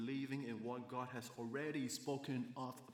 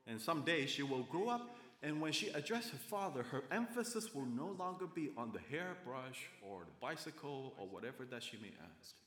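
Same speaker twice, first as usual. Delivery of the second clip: normal talking voice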